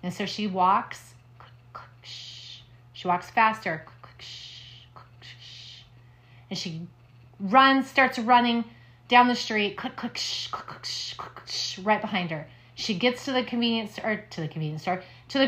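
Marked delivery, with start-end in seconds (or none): 1.4-2.6 soft unvoiced clicks and a long shh; spooky whispered clicks. A narrator's reading. Scary sound in ghost story. 3.8-5.8 slow whispered clicks and hushing shh; slow spooky whisper. A narrator's reading. Scary sound in ghost story. 9.8-11.8 soft unvoiced clicks and a long shh; spooky whispered clicks. A narrator's reading. Scary sound chasing her.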